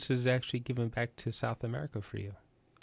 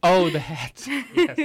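much higher and louder oh; amused realization; 8 semitones higher, 14 dB louder, much slower than usual. Real, not acted. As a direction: catch the joke late and laugh. Realizing the hats joke.

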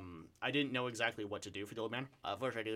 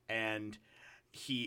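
ordinary talk

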